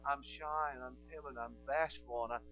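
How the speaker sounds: mock timid excuse. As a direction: mimic a shy, whiny excuse-maker. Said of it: "don't ever come up to me again and say".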